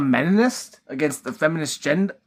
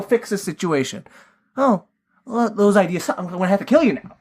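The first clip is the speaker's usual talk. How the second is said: higher, overly chummy crime boss, fast on the threats; mock-cheerful gangster, pitched up; friendly villain; 8 semitones higher, faster than usual. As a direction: play the host pouring drinks, then threaten breezily. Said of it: "he's like".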